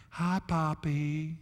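lightly imitating a small grandson's soft, slow greeting; a hint of a shy little boy saying hi; toddler voice. Grandfather imitating his grandson on the phone, softly and slowly. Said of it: "It is the cutest little voice".

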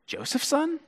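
Joseph's son? rising sharply high and soft; Joseph's son? in a high, soft disbelieving rise; incredulous question. Retold or imitated. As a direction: ask "Joseph's son?" as if it can't be true. Crowd questions Jesus' origins.

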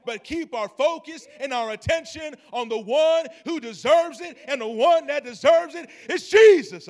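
loud rising preaching peak; preaching climax. Acted. Pastor to congregation, loud climactic preaching. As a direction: shout the name to the rafters.

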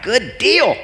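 bright, pleased exclamation; excited. Retold or imitated. Pastor retelling his own happy reaction, excited. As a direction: cheer it like a done deal. Excited self-quote on selling house.

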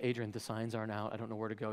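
low, hushed, small voice; acted-out worried aside. Lost husband phoning his wife for directions, low and meek. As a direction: quietly confide you're lost, as if on the phone. Anxious phone call to wife.

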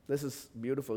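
ordinary talk

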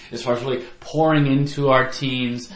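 usual speaking voice

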